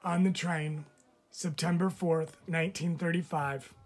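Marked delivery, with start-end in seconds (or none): none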